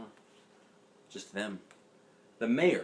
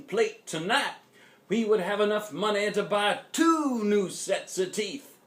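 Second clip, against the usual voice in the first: louder preacher voice, rising to the end; Pastor Butter; 7 semitones higher, 5 dB louder than usual. A narrator's reading. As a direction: preach it to the town hall. Pastor continues speech.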